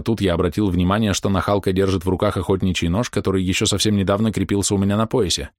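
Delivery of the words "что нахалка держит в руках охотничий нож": usual speaking voice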